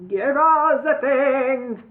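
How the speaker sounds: loud, slow howl. A narrator's reading. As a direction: howl out the demand. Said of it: "howled Cluck".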